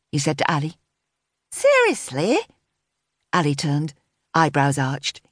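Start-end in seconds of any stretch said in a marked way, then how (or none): 1.5-2.4 put-on high, swooping teenage disbelief; incredulous. A narrator's reading. Daughter told to take a coat, incredulous. Daughter objects to dad's order.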